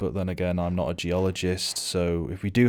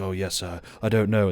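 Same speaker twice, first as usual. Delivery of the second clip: usual speaking voice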